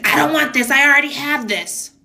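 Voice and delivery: high-pitched mock tantrum of a spoiled child; bratty kid. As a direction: imitate a kid rejecting a present. Acts out bratty kid rejecting gift.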